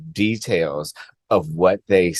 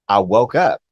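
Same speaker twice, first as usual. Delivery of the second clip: ordinary delivery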